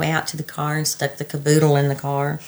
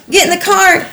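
urgent yell; 13 semitones higher, 5 dB louder than usual. Retold or imitated. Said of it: "retelling screaming at daughter".

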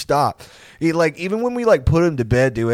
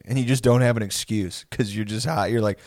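normal talking voice

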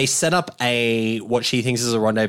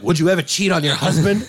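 higher-pitched, acted blurted question; 6 semitones higher than usual. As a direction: jump in with a mock interrogation. Acting Tom Arnold's interrogation question.

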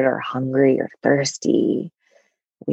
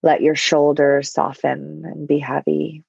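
ordinary talk, nothing marked

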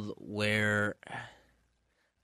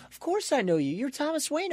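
high-pitched, exasperated retort; acted incredulity; faster than usual. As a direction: voice climbing as if stating the obvious. Barry answers the intruder incredulously.

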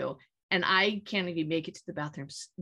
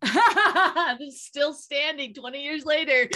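higher, louder, laughing reply; 6 semitones higher, 5 dB louder, slower than usual. Real, not acted. Guest topping the host's one-night stand joke, laughing. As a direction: burst out laughing and push the words through.

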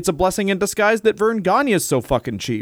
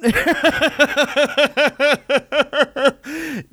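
big laugh; 9 semitones higher than usual. Real, not acted. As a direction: crack up at the joke.

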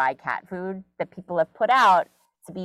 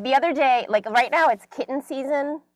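usual speaking voice

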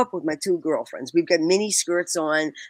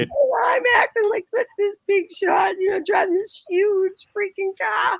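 laughing while telling; 14 semitones higher than usual. Real, not acted. Teller mocking her teen self to the host, laughing. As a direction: giggle through the boast.

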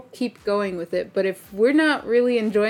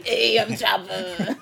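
chain smoker voice; slower than usual. Pretend. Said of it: "sounded like a chain smoker".

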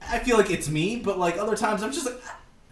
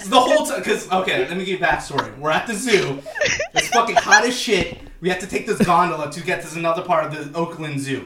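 ordinary talk, nothing marked

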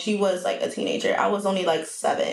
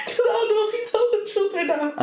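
high, helpless laughter through the words; real giggling, pitched way up; laughing; 10 semitones higher than usual. Real, not acted. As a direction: struggle to talk while cracking up. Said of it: "Why are you laughing".

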